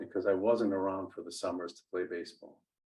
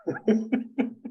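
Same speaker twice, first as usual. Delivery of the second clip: laughing; 4 semitones higher, 4 dB louder than usual. Real, not acted. Guest jokes about his Italian name.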